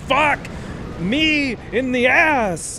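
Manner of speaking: loud, high, drawn-out retold outburst; mock shouted exclamation. Streamer retelling the restaurant staff's answer, mock shout. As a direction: imitate someone crying out, stretching the words.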